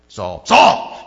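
loud, impatient calling of a name; exasperated call. Shown as a demonstration. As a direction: call him like he's not listening. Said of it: "when you have to say it more than once ... somebody's not listening".

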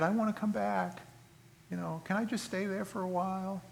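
a cheater begging to come home, coaxing; wheedling cheating spouse. Acted. Preacher playing the cheating husband, pleading softly.

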